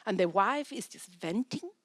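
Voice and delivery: ordinary talk, nothing marked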